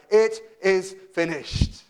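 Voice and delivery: loud, slow, weighty proclamation; triumphant cry. Retold or imitated. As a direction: cry it out slowly, word by word. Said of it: "he has cried".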